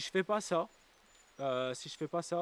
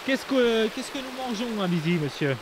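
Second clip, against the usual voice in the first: to someone hard of hearing; 7 semitones higher, 6 dB louder than usual. Pretend. Interviewer role, loud questions.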